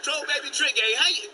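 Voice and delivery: excited hello to a homie; hyped greeting. Real, not acted. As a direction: shout out a friend you're glad to hear. Hyped greeting of known caller.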